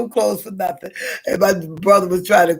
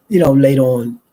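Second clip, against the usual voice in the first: ordinary talk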